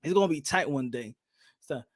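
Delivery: usual speaking voice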